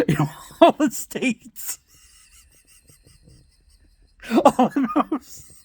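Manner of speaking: real laughter breaking into high-pitched words; laughing while talking. Real, not acted. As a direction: try to talk through a laughing fit.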